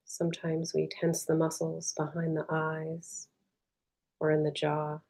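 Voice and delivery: soft, unhurried meditation voice with long pauses; guided meditation. Real, not acted. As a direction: guide them gently inward, leaving space between phrases.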